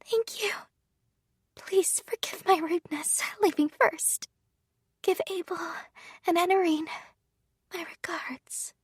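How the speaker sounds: put-on faint, high, breathless whisper of a dying girl; fading gratitude, barely voiced; dying young maid. Dying maid's last words.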